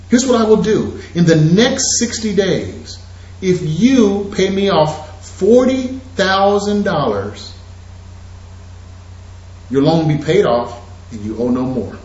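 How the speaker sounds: usual speaking voice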